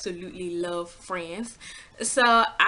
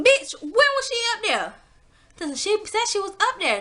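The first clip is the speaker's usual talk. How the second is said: indignant outrage; 13 semitones higher than usual. Retold or imitated. Indignant at nurse.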